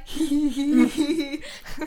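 high, loud mock giggle; drawn-out high tittering; imitated giggling. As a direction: imitate someone laughing behind a door. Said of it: "through the doors being like".

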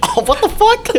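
very high-pitched, incredulous outburst; mock-shocked squeak of disbelief; retold shock. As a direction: replay your baffled reaction, voice leaping up. Acting out shocked reaction to dad.

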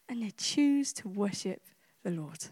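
slow, low and very soft; quiet, weighty wonder; hushed awe. Real, not acted. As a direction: let it sink away reverently. Solemn close of imagined suffering.

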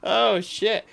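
usual speaking voice

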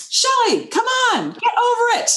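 a high, firm self-scolding pep talk. Retold or imitated. Giving herself a pep talk.

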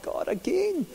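a sudden high groan of here we go again; exasperated disbelief. Retold or imitated. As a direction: flung up to God in disbelief. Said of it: "And I'm like".

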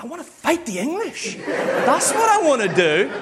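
put-on earnest boy answer. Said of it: "And I was like".

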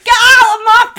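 high, loud, hammed-up bellow; mock soap landlady shout. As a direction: roar like a soap pub landlady. EastEnders landlady parody.

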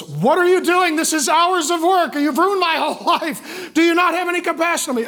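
high, loud, hammed-up outburst; exasperated mother. Exhausted mom at her child in the wrecked kitchen, exasperated. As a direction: scold at the top of your pitch like a worn-out parent. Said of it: "her first instinct was".

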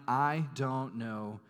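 very slow, every word pressed out; slow sworn denial. Retold or imitated. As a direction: drag out the oath. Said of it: "And Peter swore".

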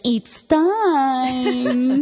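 hammed-up Dracula announcing it; a hint of a campy vampire summons. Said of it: "um, vampire".

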